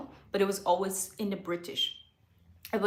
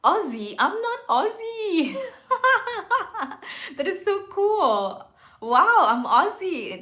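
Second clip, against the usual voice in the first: high, loud, drawn-out amazement with laughter; delighted surprise; 11 semitones higher, 8 dB louder, slower than usual. Real, not acted. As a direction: laugh in real, thrilled disbelief. Reacting to being called Aussie.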